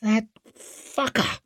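slow, high, seething hiss; angry hiss. A narrator's reading. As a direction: spit it out slowly through a hiss. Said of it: "hissed Orso as he stared at Wetterland".

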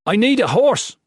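loud high yell. A narrator's reading. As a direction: shout it out. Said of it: "Jen yelled".

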